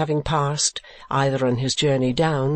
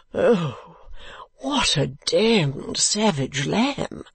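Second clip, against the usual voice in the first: crying out in slow, drawn-out dismay; higher, stretched-out foppish exclamation; Mantalini; 3 semitones higher, much slower than usual. A narrator's reading. Mantalini reacting to his wife's threat, crying out. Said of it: "cried Mister Mantalini".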